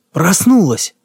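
light up with joy; joyful exclamation. A narrator's reading. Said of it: "вдруг просиял". (радостный возглас)

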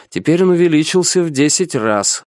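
plain reading voice

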